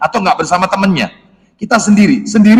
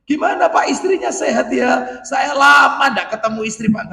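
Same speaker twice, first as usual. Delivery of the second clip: higher, eager gushing voice; over-friendly caller; 7 semitones higher, 5 dB quieter than usual. Acted. Man calling a friend's wife, over-eager and pitched higher. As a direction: gush overfamiliarly, pitched up.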